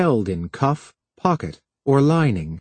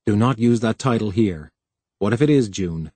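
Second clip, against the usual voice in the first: ordinary delivery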